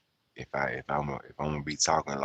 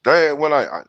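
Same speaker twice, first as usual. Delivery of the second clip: ordinary talk, nothing marked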